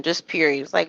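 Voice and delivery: usual speaking voice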